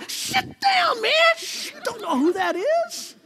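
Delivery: comic squeaky disciple voice; squeaky hush. Acted. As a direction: squeak at Peter to sit down. Disciples hushing Peter.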